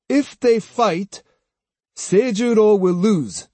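high-pitched, grand pronouncement; rather grand. A narrator's reading. Swordsman giving the house his prediction, rather grandly. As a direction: deliver it as a lofty prophecy. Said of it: "Then, rather grandly".

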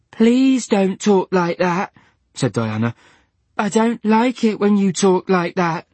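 high, slow, fragile pleading; pleading Diana. A narrator's reading. Diana begging Dylan to stop, high and slow.

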